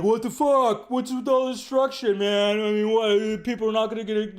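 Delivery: mocking fans angry about the destruction; mocking outraged fan. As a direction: whine like a scandalized fan.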